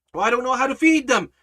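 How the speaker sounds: high, loud, hurried protest; worked-up follower. Acted. Follower fretting over feeding the crowd, loud and panicky. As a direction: blurt out the worry in agitation. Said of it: "We get all worked up".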